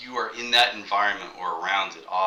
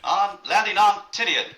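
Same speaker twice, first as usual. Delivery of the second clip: put-on old newsreel narrator, high and loud; 11 semitones higher, 6 dB louder than usual. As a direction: announce it like a wartime newsreel. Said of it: "that great newsreel voice".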